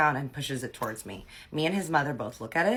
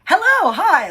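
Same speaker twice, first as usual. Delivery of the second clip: high, loud, over-bright greeting; forced cheer; 14 dB louder, slower than usual. Retold or imitated. Ex greets the waiter to lift the tense mood, in a high, loud, forced cheer. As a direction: gush a fake-cheerful hello to break the tension. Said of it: "trying to brighten up the mood".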